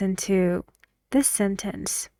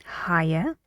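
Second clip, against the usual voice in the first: "higher" curling up at the end; "higher" said with a questioning rise; rising tune on higher; 3 semitones lower, 4 dB quieter than usual. Shown as a demonstration. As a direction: lift the voice through "higher". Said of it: "our voices need to go".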